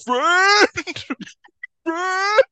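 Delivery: high, loud monster cry of Friend; monster shouting. Retold or imitated. Monster crying out to its old friend, high and loud. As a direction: bellow it like a dumb monster. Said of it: "Then Blake's like".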